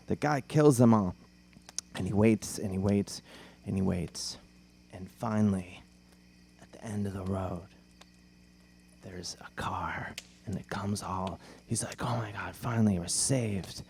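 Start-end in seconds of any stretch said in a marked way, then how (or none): 1.9-13.8 low, hushed campfire narration; hushed build-up to a twist; suspenseful storytelling. Retold or imitated. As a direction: draw out the wait, soft and low.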